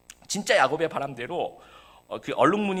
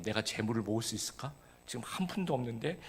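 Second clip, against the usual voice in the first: worried murmur; 7 semitones lower, 10 dB quieter than usual. Retold or imitated. Jacob's worried inner voice.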